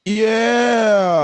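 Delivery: cheering. Real, not acted. Host cheering the comic who just finished, loud and drawn out. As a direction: whoop it out for the act.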